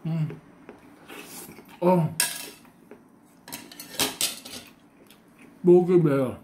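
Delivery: talking while chewing; words muffled by food; mouth full. Real, not acted. Seen 3: Bites food at -1.0; food visible in mouth, chewing throughout.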